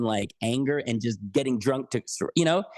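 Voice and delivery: ordinary talk, nothing marked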